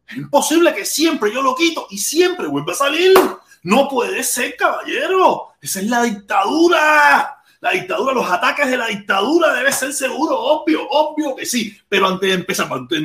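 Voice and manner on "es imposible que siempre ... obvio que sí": high, worked-up complaint at the dictatorship; vent, pitch climbing with each accusation; exasperated rant. Real, not acted. Streamer rails against the regime for sabotaging his computer, high and exasperated.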